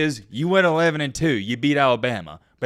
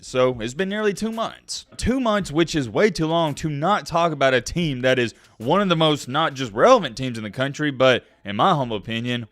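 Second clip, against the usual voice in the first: ordinary delivery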